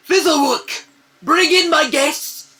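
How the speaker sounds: a master calling out for his guests; offstage summons. Acted. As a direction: summon the servant from another room.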